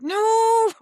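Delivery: sudden high, loud NO; mock shout. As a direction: shout it to stop yourself from feeling. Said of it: "I'm like".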